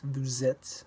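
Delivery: Vous êtes with a z sound linking into êtes; linked vous êtes. Shown as a demonstration. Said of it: "the S from vous links to the beginning".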